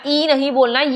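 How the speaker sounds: ordinary delivery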